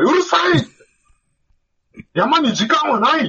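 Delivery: high, slow barked reply; angry shout. Retold or imitated. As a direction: snap back like a fierce veteran. Guide snaps at hiker.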